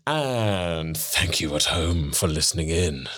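low, slow creepy voice. Pretend. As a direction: sign off like a creepy stalker. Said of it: "That was creepy".

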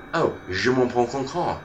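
mock French gibberish, lightly done; fake French. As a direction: pretend to speak French. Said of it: "it wasn't even a language".

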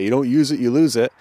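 a touch of mock sing-song; mocking the saying. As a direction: parrot an annoying cliché. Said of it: "annoying to hear people say that".

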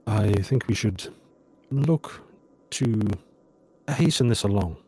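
measured suggestion with long pauses between phrases; android character. Acted. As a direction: speak haltingly, choosing each word. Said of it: "he turns to you and says".

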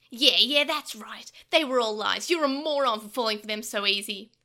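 loud, sneering villain voice; mocking gloat. Pico Devimon mocking Takeru, loud and sneering. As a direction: jeer at the boy for being fooled.

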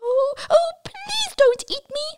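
very high begging squeak; squeaky pleading mouse. Acted. Mouse begs troll.

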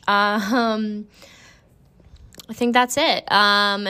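ordinary delivery

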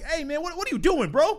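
high-pitched, baffled imagined objection; playful bewildered protest; mock incredulous protest. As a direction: act out disbelief at someone's behavior.

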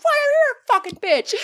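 high yelled insult through a laugh. Retold or imitated. Yelling at the driver while laughing, high yell. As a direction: yell it back, half laughing. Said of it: "I'm like".

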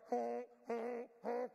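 loud mock honks; honking like geese; goose honk. Shown as a demonstration. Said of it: "They're doing this".

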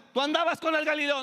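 high, loud accusation; accusing shout. Retold or imitated. Servant girl accusing Peter, shouting high. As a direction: accuse him loudly. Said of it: "como mismo Pedro".

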